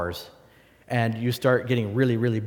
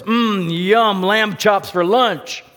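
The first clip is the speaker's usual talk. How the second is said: higher, louder, slow greedy bear voice; hungry bear; 7 semitones higher, 6 dB louder, much slower than usual. Pretend. The bear spots the sheep and gloats over lunch, greedy. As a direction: relish it like a bear eyeing lunch. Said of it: "the bear goes".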